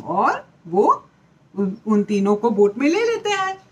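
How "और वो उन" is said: plain reading voice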